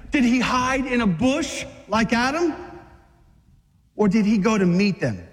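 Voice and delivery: ordinary delivery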